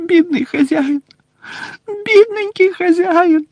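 high tearful elf lament; sobbing house-elf. Acted. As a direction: whimper like a grieving elf. Winky sobbing. (схлипує ельфійка)

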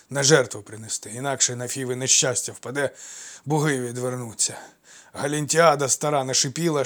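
loud, breathless, panicky telling; frightened old servant. Acted. Old servant reports the coming mob to his master in panic. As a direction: blurt it all out, terrified and winded.

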